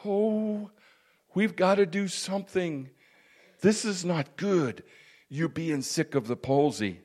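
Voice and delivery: mock-tearful lament, high and soft; Compassion, tearful. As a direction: act a helpless, soft cry of pity. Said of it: "would just sit there and cry".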